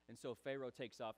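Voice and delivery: normal talking voice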